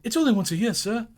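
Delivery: timid clerk pleading with his employer. A narrator's reading. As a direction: beg the boss for mercy. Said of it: "pleaded Bob".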